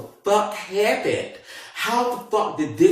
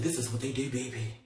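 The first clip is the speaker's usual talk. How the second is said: hushed warning; 5 semitones lower, 15 dB quieter than usual. Retold or imitated. Detective hushed.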